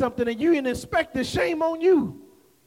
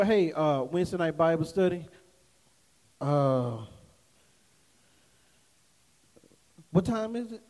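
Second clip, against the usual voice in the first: hesitant stalling; 8 semitones lower, 4 dB quieter than usual. Retold or imitated. Acts out a hesitant church invitation exchange.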